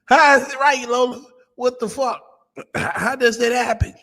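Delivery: high-pitched, incredulous demand for an explanation; mock bewilderment. Mock bewildered reaction to her claim.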